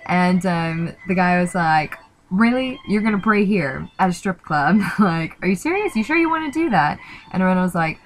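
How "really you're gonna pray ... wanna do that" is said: higher, louder incredulous bouncer, rising fast at the end; can't believe she wants to pray here; shocked disbelief. Retold or imitated. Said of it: "he was kinda, like, shocked".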